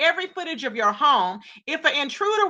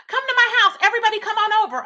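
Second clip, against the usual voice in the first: high, louder mock yell; retold yelling; 6 semitones higher, 4 dB louder, faster than usual. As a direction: imitate him hollering out an open invitation. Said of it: "He was on there just yelling".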